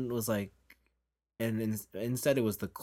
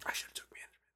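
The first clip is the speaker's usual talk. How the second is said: barely voiced whisper; whispered aside; 10 dB quieter, much faster than usual. Real, not acted. Muttered aside to himself.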